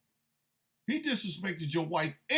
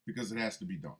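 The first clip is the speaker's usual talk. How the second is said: ordinary talk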